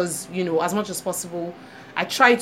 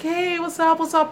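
bright high hey to colleagues; cheerful greeting; 8 semitones higher than usual. Retold or imitated. Greeting colleagues downstairs, cheerfully high. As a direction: greet them breezily, pitched up. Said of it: "my normal cheerful self".

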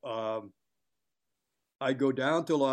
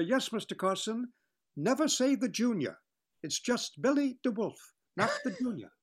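high, fussy, grand star voice; prissy haughty correction, ending in a whisper; imitating Billy DeWolf; 10 semitones higher than usual. DeWolf correcting Johnny Carson, fussy and high. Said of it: "that's exactly the way he talks".

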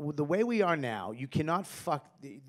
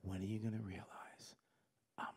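soft, then whispered I'm never gonna change; mother ending the fight on the phone, whispering; mother's quiet line; 9 semitones lower, 18 dB quieter than usual. Retold or imitated. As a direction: drop to a hush for the final blow. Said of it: "She goes".

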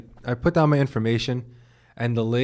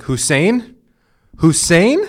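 rising, high questioning Hussein; imitating the clerk puzzling over the name; mimicking the clerk; 6 semitones higher, 6 dB louder, much slower than usual. Retelling the clerk, he mimics her puzzled Hussein.

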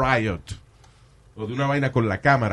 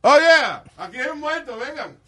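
panicked emergency call; 12 semitones higher, 3 dB louder than usual. Retold or imitated. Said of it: "acting caller".

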